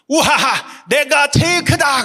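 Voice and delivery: put-on boastful child. Father imitating his daughter on the chair, gleeful shout. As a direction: crow like a five-year-old on a chair. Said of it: "이렇게 말했어요".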